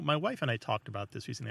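normal talking voice

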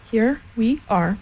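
each word drawn out deliberately; slow and careful. A narrator's reading. Rabbit addressing the others gravely, slow and careful. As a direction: say it very slowly, with care. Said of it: "said Rabbit very slowly and carefully".

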